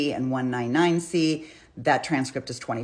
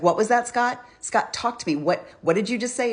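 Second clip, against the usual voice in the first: higher-pitched, mildly exasperated imitation of the caller; imitated frustration; 8 semitones higher than usual. Frustrated caller pressing Scott to talk to her, imitated. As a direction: retell her exasperated questions, pitched well up. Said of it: "I can hear her frustration".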